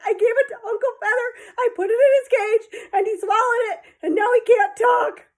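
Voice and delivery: high and loud, wailing through tears; sobbing child. Acted. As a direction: blurt it out between sobs. Crying child explains.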